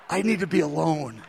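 low, hushed, subdued request; retold choked-up withdrawal; softly, as if too moved to talk; overwhelmed. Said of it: "I begin to tear up".

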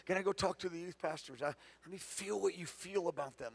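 soft, murmured prayer under the breath; hushed whisper-like prayer while walking; quiet inner prayer. Shown as a demonstration. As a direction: pray it quietly to yourself. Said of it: "I'll just say".

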